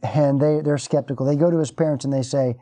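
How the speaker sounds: ordinary talk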